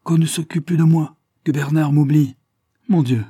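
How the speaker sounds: plus aigu et plus fort, voix de jeune femme suppliante; voix de Janine au téléphone. A narrator's reading. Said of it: "la voix bien-aimée qui disait". (Janine implore Bernard au téléphone, voix aiguë et forte)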